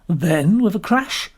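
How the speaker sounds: plain reading voice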